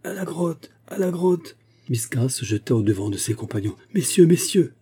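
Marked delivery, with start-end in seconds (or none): 0.0-1.6 high cry carried through a cave; a menacing echo of a rallying call. A narrator's reading. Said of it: "l'écho du souterrain alla porter comme une menace". 3.9-4.7 high, loud urgent appeal; alarmed call for attention; crying out. A narrator's reading. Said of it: "s'écria-t-il".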